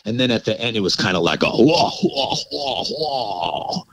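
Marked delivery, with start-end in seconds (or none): 1.5-3.8 soft, drawn-out "wah" laugh; imitated eerie laugh. Man imitating the creature's strange laugh-like ending, soft wailing laugh. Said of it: "it sounded like a laugh".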